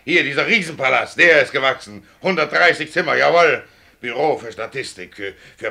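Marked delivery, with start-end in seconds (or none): 0.0-3.6 lauter, höher empörter Protest. Acted. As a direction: schleudert dem Direktor die Worte laut entgegen. Fahrer beschwert sich beim Direktor. (empört und laut gegen den Direktor)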